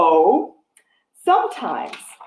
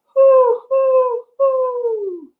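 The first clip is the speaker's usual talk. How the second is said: owl hoots; 6 dB louder, much slower than usual. Shown as a demonstration. Teacher showing kids owl sounds, high hooting. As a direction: hoot up high like an owl, trailing off. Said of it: "Can you make owl sounds?".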